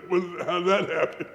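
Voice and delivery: retold astonishment with a chuckle; laughing surprise. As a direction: ask it through a laugh, amused and amazed.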